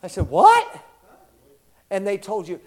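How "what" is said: high, loud incredulous what; stunned retort; shocked what. Retold or imitated. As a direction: blurt it out in disbelief. Shocked retort at gym rule.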